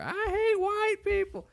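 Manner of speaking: high, slow mock shriek of a street lady; mimicking screaming lady. Retelling friends the lady's mock high rant. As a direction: ham up the ranting homeless woman. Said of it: "just over and over".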